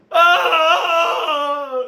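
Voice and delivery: high startled yelp; a hammed-up shriek at the mirror; mock shocked scream. Scott seeing himself in the mirror, mock shocked yelp. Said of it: "looks at the mirror and goes".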